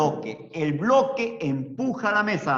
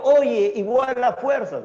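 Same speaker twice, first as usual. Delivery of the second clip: "Oye, igual las fuerzas" higher, louder and slow, mocking the wrong way; hammed-up wrong version, higher and louder; wrong phrasing mocked; 7 semitones higher, 6 dB louder, slower than usual. Shown as a demonstration. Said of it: "No, yo te digo". ("Oye, igual las fuerzas" dicho más agudo y fuerte, burlándose de la forma incorrecta)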